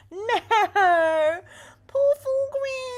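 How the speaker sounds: high, drawn-out hammy cry of grief; mock anguished wail. Streamer mock-mourning a chat joke, hammy loud wail. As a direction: wail over poor Thorgrim like a melodrama.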